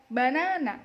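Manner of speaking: banana with a loud, rising-falling middle NA; stress on second syllable. Shown as a demonstration. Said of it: "press to the second syllable".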